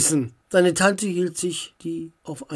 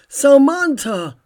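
loud, high, drawn-out call; 8 semitones higher, 8 dB louder, slower than usual. A narrator's reading. Uncle to Samantha as she flies over, calling out. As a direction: call across to her urgently. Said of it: "rief Onkel Alfred ihr zu".

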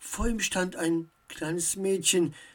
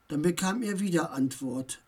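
plain reading voice

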